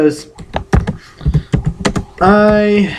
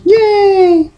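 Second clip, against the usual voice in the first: high, loud burst of joy; excited cheer; 4 dB louder than usual. Real, not acted. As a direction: cheer like you just won. Realises he was right.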